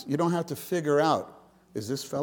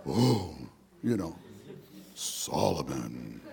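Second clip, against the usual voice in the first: voice of God; 11 semitones lower, 4 dB quieter, slower than usual. Pretend. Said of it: "rarely is there like a".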